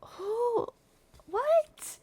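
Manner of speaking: high, startled who what; confused surprise. Retold or imitated. Me to the man who smacked me, high startled confusion. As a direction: caught off guard, squeaky and baffled. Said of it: "And I'm like".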